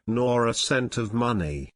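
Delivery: plain reading voice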